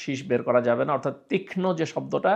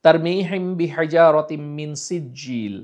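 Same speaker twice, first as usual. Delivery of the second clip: measured Quran recitation; slow recitation; 5 dB louder, slower than usual. Shown as a demonstration. Teacher reciting for students, slow. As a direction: recite slowly. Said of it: "teacher recites Surah Fil".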